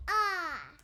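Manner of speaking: say ah. Acted. Patient says ah.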